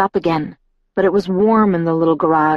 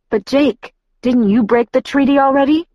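higher-pitched, pleading challenge; grasping at straws; 8 semitones higher, 3 dB louder than usual. A narrator's reading. Bella argues Jacob already broke the treaty, grasping at straws. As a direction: raise the pitch, reaching for any argument. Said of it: "grasping at straws".

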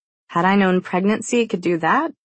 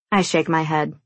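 ordinary delivery, nothing marked

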